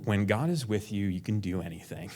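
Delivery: usual speaking voice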